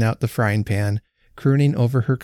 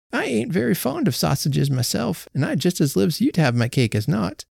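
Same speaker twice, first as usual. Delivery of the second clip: put-on timid old woman; 7 semitones higher than usual. A narrator's reading. Old Betsy offers Jenny her sausage cake, high and shy. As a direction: offer it shyly as an old lady.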